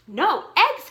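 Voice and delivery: crying out. Acted. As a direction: shout it out, the farmer is appalled. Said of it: "cried Farmer Brown".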